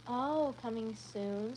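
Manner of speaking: ordinary talk, nothing marked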